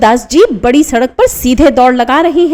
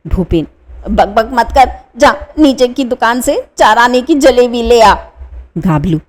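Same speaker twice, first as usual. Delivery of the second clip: ordinary talk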